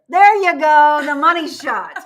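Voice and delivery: loud, high burst of delight; excited cheer. Real, not acted. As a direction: cheer as the shot lands. Dog finally faces the camera.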